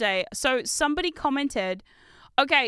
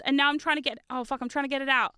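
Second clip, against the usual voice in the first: usual speaking voice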